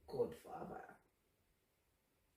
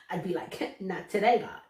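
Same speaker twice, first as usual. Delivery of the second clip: louder, laughing through the words; 10 dB louder than usual. Retold or imitated. Joking she'd tell God no, laughing. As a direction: toss it off with a laugh.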